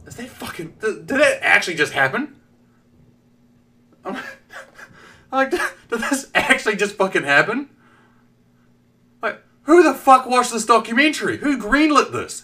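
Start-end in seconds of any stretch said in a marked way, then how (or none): 0.1-2.4 disbelief. Retold or imitated. Own disbelief quoted. 5.5-12.4 incredulous rant. Acted. Stunned ranting.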